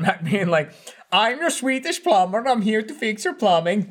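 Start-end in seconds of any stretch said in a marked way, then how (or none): 1.1-3.9 put-on higher-pitched comic Swedish accent; mock Swedish accent. Quoting Mac's plumber bit to friends, mock Swedish accent. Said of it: "It was Mac doing the accent".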